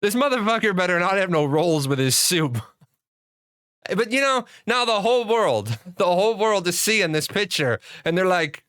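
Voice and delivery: ordinary talk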